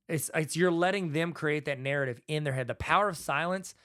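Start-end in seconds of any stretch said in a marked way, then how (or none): 0.1-0.3 an audible, thoughtful sigh; demonstration sigh. Shown as a demonstration. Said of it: "you can even go".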